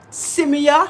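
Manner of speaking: Simia called out loud and rising; proud loud Simia; emphatic Simia. Real, not acted. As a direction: throw out Simia with a big rise. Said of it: "what that word means".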